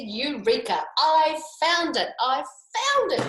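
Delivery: high, loud, slow cries of joy; high, loud, slowed joyful shout; excited shout. Acted. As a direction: shout the discovery. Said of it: "he shouted".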